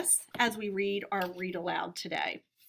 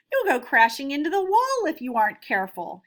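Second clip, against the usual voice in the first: lightly voiced warning, louder and fast; 4 semitones higher, 7 dB louder, faster than usual. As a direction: caution them, a little hurried. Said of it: "he warned".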